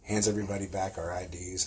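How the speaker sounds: ordinary talk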